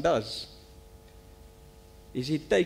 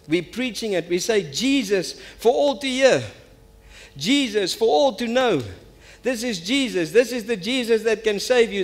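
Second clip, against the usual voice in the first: raised pitch, ringing declarations; fervent preaching; 6 semitones higher, 4 dB louder than usual. Real, not acted. Preacher declares the year's theme to church, fervent preaching. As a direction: proclaim it to the whole congregation.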